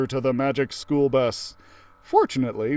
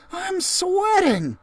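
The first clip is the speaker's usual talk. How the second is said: put-on very high, slow, drawn-out kid voice; Ralphie, sweating; much slower than usual. Ralphie telling the class he's sweating, slow and high. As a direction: stretch it out like you're melting.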